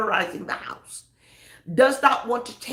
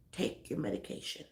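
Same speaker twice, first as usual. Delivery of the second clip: much softer, a whispered urging; whispered plea; 5 semitones lower, 10 dB quieter than usual. Acted. Pastor pleading with anyone depressed, soft whisper. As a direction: lean in and whisper it.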